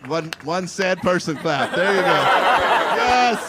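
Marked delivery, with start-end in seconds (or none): 1.7-3.5 excited cheer. Real, not acted. Cheering one clapper.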